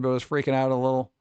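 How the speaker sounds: ordinary talk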